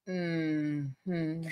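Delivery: low, quiet, drawn-out hum; a slow, judging hum; disapproving mm-hmm. Retold or imitated. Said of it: "He just went".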